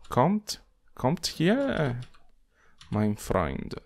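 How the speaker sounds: put-on a light, playful German accent beckoning; hammed-up German accent calling the mob closer; a hint of German accent, coaxing a monster over; mock German accent. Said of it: "let's not do German accents".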